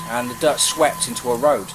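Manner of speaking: ordinary delivery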